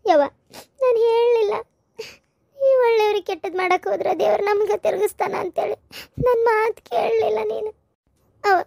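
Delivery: high, slow lamenting cry; tearful wailing. Acted. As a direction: wail in despair, voice breaking into tears. Mother-in-law pleads after curse backfires. (ಅಳುತ್ತಾ ಗೋಳಾಡುವ ಧ್ವನಿ)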